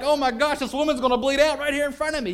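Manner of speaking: high, loud cry of alarm; panicked outburst at full volume. Retold or imitated. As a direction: blurt it out in terror. Panic, retold with full force.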